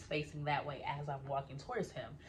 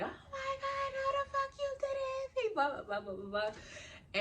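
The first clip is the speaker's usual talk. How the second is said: reenacted angry yelling, much higher, breaking into crying; much higher, crying rage at the boyfriend. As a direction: shout it through tears, high and furious. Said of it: "And I'm just like".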